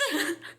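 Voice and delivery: quick amused laugh; short laugh. Real, not acted. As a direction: laugh briefly. Laugh after story.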